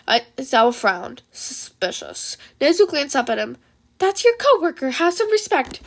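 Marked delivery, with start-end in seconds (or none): 1.3-2.4 whispered, much softer, slow. Acted. Aizawa judging the email, whispered. 4.0-5.8 higher, scolding; a little principal's reprimand; Nezu voice. Acted. Nezu scolding Aizawa, higher reprimand.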